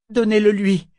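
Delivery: high, loud anguished yielding; reluctant giving in. A narrator's reading. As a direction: force the words out at last. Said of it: "finit-elle par dire".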